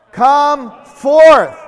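loud, drawn-out call of a name; commanding call. Acted. Jesus calling Lazarus from the tomb, loud and commanding. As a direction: call him out of the grave.

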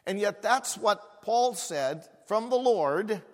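loud, high preacher's build; fervent sermon climax; preaching at full force. Acted. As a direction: preach it with rising fire.

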